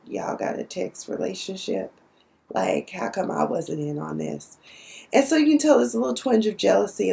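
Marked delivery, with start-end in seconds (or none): 0.1-4.6 hushed, breathy aside; jealous whisper. Retold or imitated. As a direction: whisper it like you're hurt and left out. Charlie jealous over text relationship.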